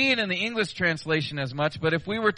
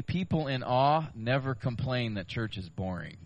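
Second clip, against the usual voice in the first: quoted aside; 6 semitones lower, 7 dB quieter than usual. Retold or imitated. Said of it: "R C Sproul said".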